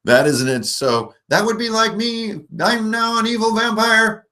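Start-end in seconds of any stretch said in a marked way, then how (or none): 1.3-4.2 normal voice pushed way up high; high-pitched evil vampire; higher voice. Shown as a demonstration. Game master demoing simple voice changes, very high. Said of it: "normal voice, higher".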